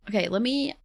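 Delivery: ordinary talk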